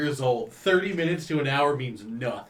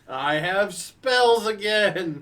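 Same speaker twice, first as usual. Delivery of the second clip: stretched slow and loud; slow drawn-out relief; 6 semitones higher, 5 dB louder, much slower than usual. Acted. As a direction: draw it out happily. Celebrating long rest, drawn out.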